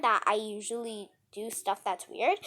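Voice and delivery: plain reading voice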